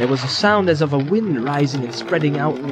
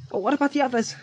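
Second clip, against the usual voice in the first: Frodo voice; 9 semitones higher, 4 dB quieter, much faster than usual. A narrator's reading. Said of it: "Frodo asked".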